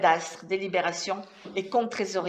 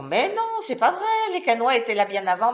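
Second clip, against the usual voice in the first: higher, louder protest; indignant objection; 3 semitones higher, 4 dB louder than usual. Acted. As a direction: voice jumping up in protest. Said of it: "ils vous diront".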